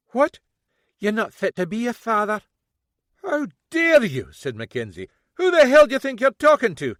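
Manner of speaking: loud, high outraged shout; how dare she, blast it out; furious dad shouting back; outraged father. A narrator's reading.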